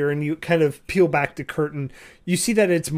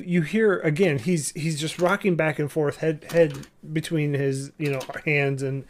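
ordinary talk, nothing marked

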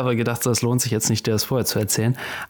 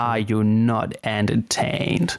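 Gladiator quote; 2 semitones lower, much slower than usual. Retold or imitated. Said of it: "Es kommt aus Gladiator".

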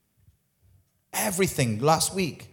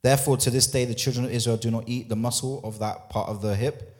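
1 scripture reading; 5 semitones lower than usual. Acted. Reads scripture passage.

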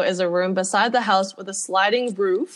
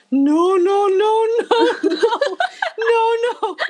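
high, loud comic panic; hammed-up frantic escape voice; panicked refusal; 12 semitones higher, 4 dB louder, much slower than usual. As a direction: cry it out higher and higher while fleeing. Acted panicked reply while escaping.

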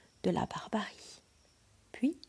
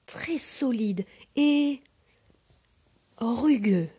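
raised excited exclamation; crying out; 5 semitones higher, 5 dB louder than usual. Acted. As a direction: exclaim it, then grope slowly. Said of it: "Un autre s'écria tout de suite".

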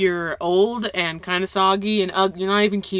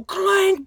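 clank sound effect; 13 semitones higher, 6 dB louder than usual. Retold or imitated. Imitated noise of stumbling.

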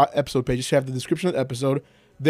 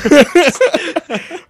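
loud high laugh; 10 dB louder than usual. Real, not acted. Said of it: "laughs at the joke".

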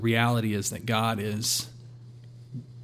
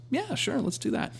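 much higher, light agreeable reply; easy agreement; 14 semitones higher, 3 dB quieter than usual. Retold or imitated. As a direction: agree readily in a raised pitch. Voicing God's easy agreement.

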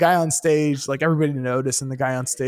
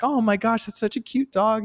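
higher, lightly voiced sweet lady; sweet librarian; 8 semitones higher than usual. Librarian lady cooing at a girl's dog, lightly voiced. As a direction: coo a little over the dog. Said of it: "librarian lady's like".

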